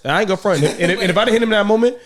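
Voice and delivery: ordinary delivery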